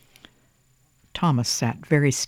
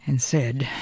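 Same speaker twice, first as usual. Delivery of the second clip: plain reading voice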